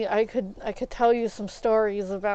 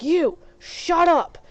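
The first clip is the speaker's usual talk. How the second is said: slow, high, louder mock scolding; imitating stern nurse; 7 semitones higher, 6 dB louder, slower than usual. Nurse silencing chatty doctor.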